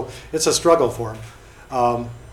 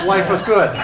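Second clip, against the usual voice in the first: cheerful exclaim; 8 semitones higher, 8 dB louder than usual. Retold or imitated. Cheerful retort to George.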